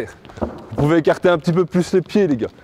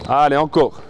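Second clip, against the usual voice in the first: usual speaking voice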